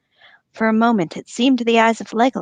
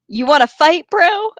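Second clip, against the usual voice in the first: rising challenge, mock bro; hammed-up bro taunt; 6 semitones higher, 4 dB louder than usual. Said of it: "draw one's sword and say".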